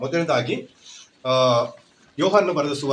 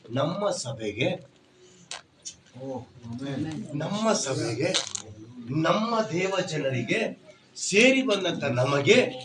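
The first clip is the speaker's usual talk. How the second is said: gentle address; slower than usual. Acted. Slow soft address to church.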